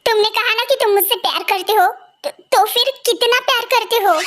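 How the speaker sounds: ordinary delivery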